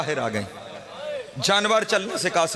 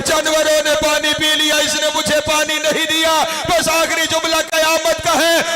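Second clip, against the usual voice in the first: much higher and louder, keening wail; full-force lament preaching; 9 semitones higher, 6 dB louder than usual. Acted. Preacher wailing Sakina's grief to the majlis, full-force keening lament. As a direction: cry it out to the mourners, rising to the peak.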